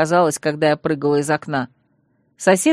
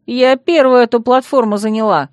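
raised, loud protest; indignant; 5 semitones higher, 5 dB louder than usual. A narrator's reading. Bride claims the platform from a newcomer, indignant. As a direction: stake your claim indignantly. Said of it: "возмутилась".

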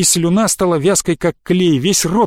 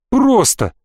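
high, incredulous exclamation; indignant echo; 8 semitones higher than usual. A narrator's reading. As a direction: fling the word back. (возмущённо повторить)

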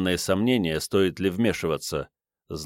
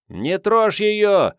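high, slow yell; 11 semitones higher, 4 dB louder, much slower than usual. Acted. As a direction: yell from the crowd. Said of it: "завопил".

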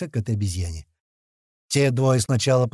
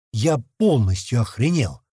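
usual speaking voice

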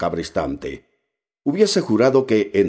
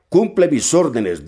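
dry, clipped command, higher and louder; captain curtly dismissing the cook; curt order; 9 semitones higher, 5 dB louder than usual. A narrator's reading. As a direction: snap the order out coldly. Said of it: "dijo el capitán con sequedad".

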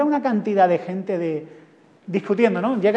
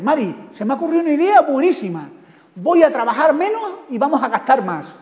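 eager husband; 5 semitones higher, 4 dB louder than usual. Acted. Husband pitching silly idea to wife.

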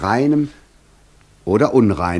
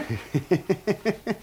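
high mocking laugh; 9 semitones higher, 5 dB quieter than usual. Devil mocks the master idea.

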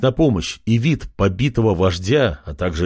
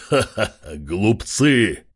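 evil chuckle; slower than usual. Acted. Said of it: "Жутким голосом расхохотался Кракен".

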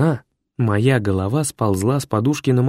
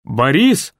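calling out again; 3 semitones higher, 4 dB louder than usual. A narrator's reading. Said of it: "закричал я".

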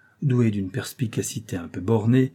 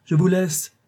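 high loud call; 9 semitones higher, 5 dB louder than usual. A narrator's reading. Mascaro calling to the couturier as he leaves, high and loud. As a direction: call back on the way out. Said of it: "cria-t-il à Von Kloppen".

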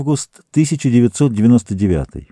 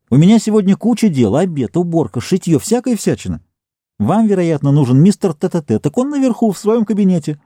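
fussy housewife; 7 semitones higher than usual. Acted. Busy housewife rattles off chores.

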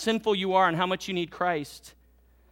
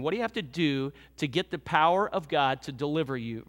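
usual speaking voice

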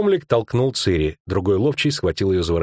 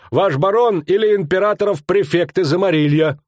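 higher, louder bragging hunter; boastful rustic thug; 9 semitones higher, 3 dB louder than usual. Acted. Skomlik bragging to Verk, boastful rustic voice. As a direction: brag with wounded pride.

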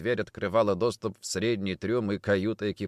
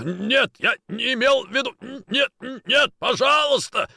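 high, loud frantic begging; desperate pleading; 14 semitones higher, 5 dB louder than usual. Acted. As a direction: beg for your life in panic. Boatswain begging to be spared.

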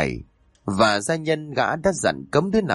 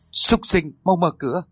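furious yell; 6 semitones higher than usual. A narrator's reading. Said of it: "gào lên ở ngoài cửa". (gào lên giận dữ)

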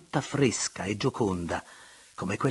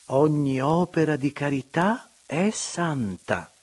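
slow, raised mock-solemn verdict; pronouncing sententiously; 6 semitones higher, 5 dB louder, slower than usual. A narrator's reading. The marchesa passing sentence on the gossip, slow mock-solemn. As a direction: deliver it like a moral. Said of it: "sentenziò la marchesa".

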